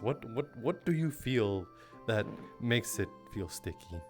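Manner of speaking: ordinary talk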